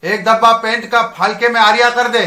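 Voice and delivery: loud barked Hindi order; a loud mock command in Hindi; retold shouted order. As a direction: call out the order across the deck.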